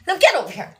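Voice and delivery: loud, high-pitched cutesy squeal; playful gushing. Pretend. [name] grabbing someone cute to squish, playful gushing.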